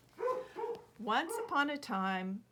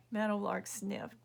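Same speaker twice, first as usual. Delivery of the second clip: usual speaking voice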